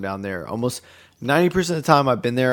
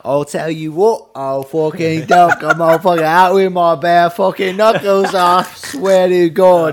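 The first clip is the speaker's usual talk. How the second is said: loud, higher, slow hammed-up British fighter; slow, loud mock British threat; mock British brawler; 7 semitones higher, 8 dB louder than usual. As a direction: bellow like a bare-knuckle Brit. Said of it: "the British, they were like".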